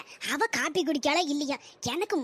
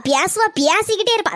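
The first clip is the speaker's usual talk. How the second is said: usual speaking voice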